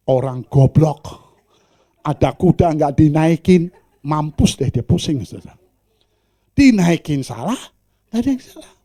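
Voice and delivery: loud contemptuous jeer; loud scornful gossip; mocking onlooker. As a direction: sneer at the foolish pair. Bystander mocking.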